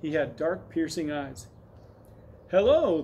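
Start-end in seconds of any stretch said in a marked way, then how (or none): 2.5-3.0 put-on high, loud boyish shout; shouted greeting. A narrator's reading. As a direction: holler hello up to them. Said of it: "the boy shouted when he saw".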